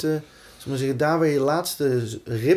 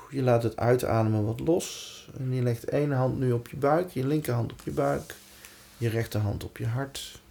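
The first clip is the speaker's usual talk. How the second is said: calm, unhurried meditation voice; guided meditation. Acted. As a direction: gently lead them into relaxation. Guides a body relaxation exercise.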